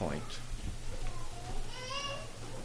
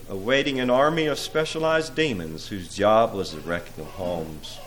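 rhythmic, theatrical storytelling in verse; dramatic poem recitation; 3 semitones higher, 7 dB louder than usual. A narrator's reading. Preacher reads The Wreckers of Homes to listeners, dramatic recitation. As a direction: recite it like a dark fable with swelling emphasis.

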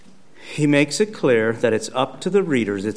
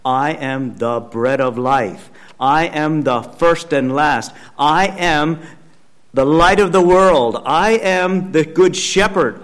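preacher's mounting, ringing proclamation; proclaiming as divinity; 7 dB louder, slower than usual. Acted. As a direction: declare each title bigger than the last. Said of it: "Only divinity can talk like this".

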